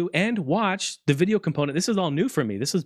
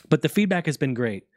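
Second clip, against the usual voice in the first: ordinary talk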